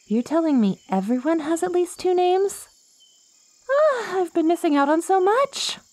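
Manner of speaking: high, eager wonder; bubbling over with amazement; excited. Acted. Plant woman marveling that everyone has two names, excited.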